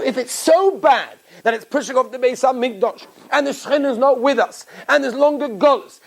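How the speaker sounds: normal talking voice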